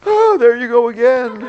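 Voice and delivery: very high, loud laughing whine; hammed-up exasperated wife. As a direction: laugh through a mock huffy wail. Wife's exasperated retort.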